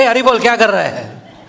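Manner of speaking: father exclaiming from behind the window; startled cry. Acted. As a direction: catch him in the act, amazed. Father sees deity eating.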